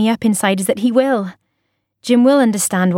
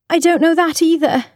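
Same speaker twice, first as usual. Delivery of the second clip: tearful, pitched up; 8 semitones higher than usual. A narrator's reading. Tearful wife confessing to husband.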